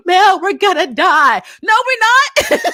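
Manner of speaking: high, loud, hammed-up panicked cry; mock panic. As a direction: mimic helpless characters in a squeal. Mocking characters' panicked exchange.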